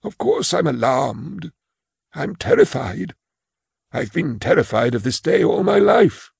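put-on high, timid old clerk voice; a frail old clerk confessing his fear; old man Imus. Imus admits terror.

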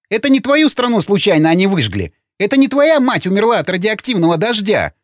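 high-pitched indignant tirade; heated accusation. Acted. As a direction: throw it in his face, high and agitated. Heated quarrel between comrades. (гневный упрёк высоким голосом)

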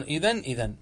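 إذن closed on a clear n; pause on nun. Shown as a demonstration. Teacher cites grammarians who pause with nun, final n. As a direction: stop on the n of إذن. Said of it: "ويقفون عليها بالنون".

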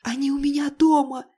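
high, panicked and shaking; trembling voice. A narrator's reading. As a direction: blurt it out in a scared tremble. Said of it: "прошептала Аня дрожащим голосом".

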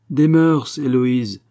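rappel à l'ordre sévère, voix haussée. A narrator's reading. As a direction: gronde un peu, plus fort et plus haut. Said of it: "dit sévèrement le promeneur". (ton sévère de réprimande)

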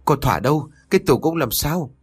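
high, fast anxious questions; alarmed questioning. A narrator's reading. Master asks about his cupboard, urgent alarm.

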